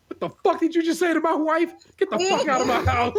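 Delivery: high-pitched imitation of an outraged shout; mock shout. Reader voicing uncle throwing nephew out of the house, high mock shout. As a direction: play the furious husband, voice jumping up without extra volume. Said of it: "My aunt's husband shouted".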